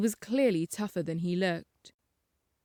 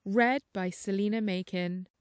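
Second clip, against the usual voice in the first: plain reading voice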